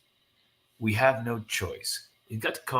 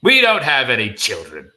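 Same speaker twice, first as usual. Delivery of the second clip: loud, forceful dad; acted father raising his voice; emphatic denial; 7 semitones higher, 6 dB louder than usual. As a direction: insist firmly at full voice. Father firmly denies the children.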